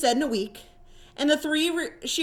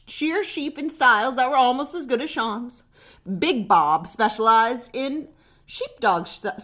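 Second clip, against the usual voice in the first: ordinary delivery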